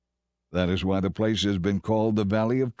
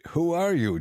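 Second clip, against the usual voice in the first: a sharp questioning demand, pitched up; suspicious leader pressing a stranger, higher and insistent; 6 semitones higher than usual. A narrator's reading. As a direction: press him for an answer. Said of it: "Joshua demanded".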